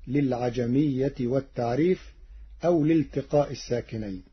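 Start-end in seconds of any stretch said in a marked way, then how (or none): none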